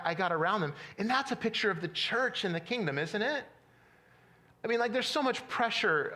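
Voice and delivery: ordinary delivery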